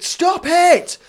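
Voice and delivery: put-on high, loud child's shout. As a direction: shout it to stop the fight. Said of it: "I shouted".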